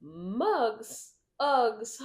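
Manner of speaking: mock-dramatic Brittany Broski. Podcast guest riffing on the host's mugs, hammed-up. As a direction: pronounce each one like a grand announcement. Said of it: "Brittany was like".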